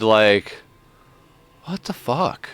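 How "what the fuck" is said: wide-eyed shock. Retold or imitated. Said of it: "it's more like wide-eyed".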